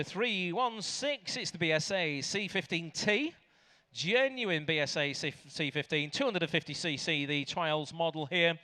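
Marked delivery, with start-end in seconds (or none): none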